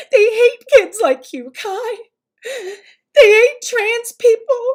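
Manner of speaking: loud, high-pitched, hammed-up sobbing mom; mock tearful wailing. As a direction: wail it like an overwrought mother, mockingly. Mocking a mother crying to child.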